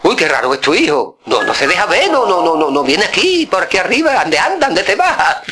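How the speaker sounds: mock complaining uncle, pitch up. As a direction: uncle grumbling about the absent boy. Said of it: "decía".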